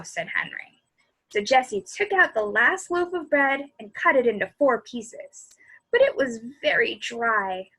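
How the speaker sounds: lively read-aloud voice; bedtime-story narration. A narrator's reading.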